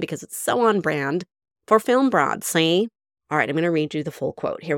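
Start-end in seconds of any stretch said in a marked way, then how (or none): 1.7-2.9 put-on Mae West accent. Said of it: "you can't not do the accent".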